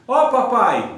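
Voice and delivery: mimicked kid's shout to his father; child calling dad. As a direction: call out proudly like a small son. E ainda me chamou.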